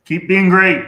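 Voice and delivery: shouted encouragement; loud cheer. Real, not acted. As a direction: call it out big and proud. Cheering the group on.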